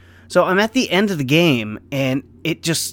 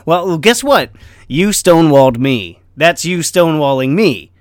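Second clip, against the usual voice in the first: louder, punchy comeback; emphatic retort; 2 semitones higher, 7 dB louder than usual. Real, not acted. Telling his chef that was stonewalling, louder and emphatic. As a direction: punch it back at him. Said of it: "explaining stonewalled".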